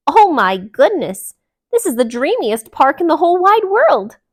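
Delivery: high, loud and gushing; a duck thrilled by the new park; loud, high, dreamy delight. Acted. Delilah gushes.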